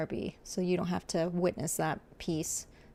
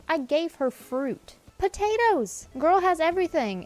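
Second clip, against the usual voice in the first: indignant; 9 semitones higher, 4 dB louder than usual. Acted. Indignant rant at villager.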